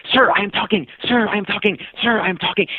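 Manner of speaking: lightly voiced insistent kid, high-pitched; pestering kid. Kid on a scooter pestering a cyclist, mock high nagging. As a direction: repeat it high and singsong, like a nagging boy.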